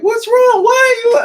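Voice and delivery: put-on high drunk yell. High-on-drugs friend yelling at him in the dark corner, a high wailing yell. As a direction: shriek the question at him. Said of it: "and she's like".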